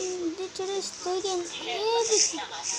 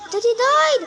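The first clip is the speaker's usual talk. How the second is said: surprised shout; 8 semitones higher, 8 dB louder than usual. Real, not acted. Gamer reacting to a kill.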